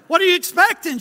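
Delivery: high, loud burst of mock alarm; retold alarmed question. Husband reacting to wife's news, acted alarm.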